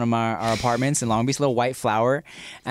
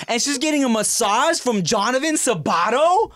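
put-on high, slow, indignant kid voice; jealous outrage; 9 semitones higher than usual. Preschooler outraged that his crush got a massage. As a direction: play the betrayed little boy, voice pitched way up. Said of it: "seeing her and be like".

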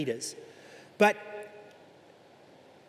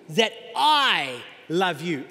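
"I love you" drawn out very slowly, louder; slow emphasis; 4 dB louder, much slower than usual. Acted. Preacher pressing God's love on the congregation, slow and emphatic. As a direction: linger on every word of "that I love you".

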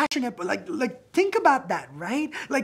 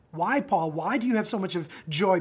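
normal talking voice

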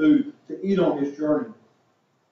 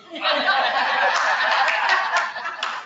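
breathy high burst of laughter at his own joke; real laugh; 14 semitones higher than usual. Real, not acted. Preacher cracking up at his own Daryl joke, real laughter. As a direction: laugh out at the punchline.

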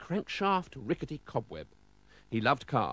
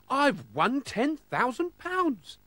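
very high, drawn-out exclamation; joyful announcement; 13 semitones higher, much slower than usual. Acted. Postman's good news for Mister Oldcastle, slow elated exclamation. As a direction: savour every word of the big win, pitched right up.

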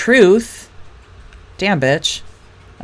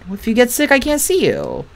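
higher, lightly voiced shy boy; voicing Jeremy; 7 semitones higher than usual. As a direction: reread it as the timid boy, pitched up.

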